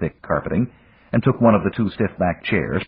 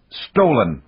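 curt one-word snap, a bit higher; snapped; 5 semitones higher, 3 dB louder than usual. A narrator's reading. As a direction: bite the word off sharply. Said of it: "the Russian snapped".

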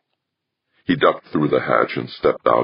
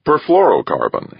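smiling; 10 semitones higher, 3 dB louder than usual. Acted. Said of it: "he explained, still smiling".

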